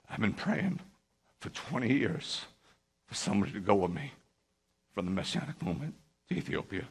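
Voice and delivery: imitating a man starting to cry; retold tearful plea. Rabbi retells man's long-held prayer for a companion, tearful. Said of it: "then he started to cry".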